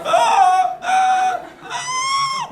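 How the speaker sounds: very high squealing gasps; hammed-up allergic shrieks. Allergic to horses at medieval times, hammed-up high squeals. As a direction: squeal in mock allergic panic.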